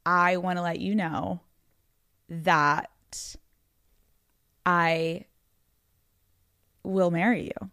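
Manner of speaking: measured, with long dramatic pauses; deliberate announcement. Retold or imitated. Telling her boyfriend she will marry him, deliberate. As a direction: draw out the reveal phrase by phrase.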